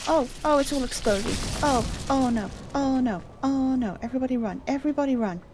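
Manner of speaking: loud, high panicked yelling; yelling while running away. Real, not acted. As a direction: shout in panic while fleeing. Explosion, fleeing in panic.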